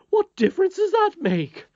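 high, loud, scornful question; scornful nurse. Acted. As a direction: sniff at the boy's claim. Nurse scoffing.

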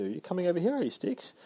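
talking to a pet. Real, not acted. Host greets Sticks mid-rant, high and soft. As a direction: lift it way up for a little buddy.

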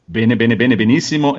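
usual speaking voice

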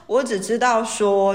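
ordinary delivery

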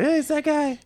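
high-pitched, excited recounted reaction; excited recognition. Retold or imitated. Recalling spotting a familiar actor on screen, excited recognition.

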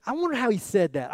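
ordinary talk, nothing marked

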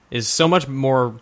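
ordinary talk

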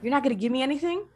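fast, high, indignant question; rushing out a high, rising "anything?"; incredulous complaint. Retold or imitated. Fan complaining to Thanatos, fast high rising question.

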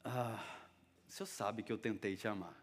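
soft, low, hesitant admission; acted sheepish reply, voice dropped; downcast. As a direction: answer as if looking down, ashamed. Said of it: "Eu imagino Pedro olhando pra baixo".

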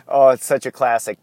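ordinary talk, nothing marked

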